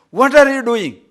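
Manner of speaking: loud, high, impatient call to the husband; wife calls him away, fed up; calling out impatiently. Acted.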